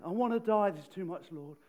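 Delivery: loud anguished cry falling to a moan; angry outburst. Retold or imitated. Jonah repeats his complaint to God, loud then deflating. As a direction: throw the first words out, then sag. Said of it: "He says it again".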